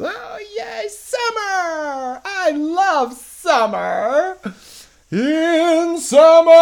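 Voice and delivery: very high, loud, drawn-out cartoon snowman squeal; gleeful little snowman, building to belted singing; Olaf. Snowman rejoicing that summer is back, loud character imitation. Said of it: "the little Olaf snowman guy is".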